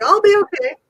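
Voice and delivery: high, loud put-on weepy voice; mock tearful. Retelling how she reassured her coach, mock tearful. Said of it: "tears are coming. I'm like".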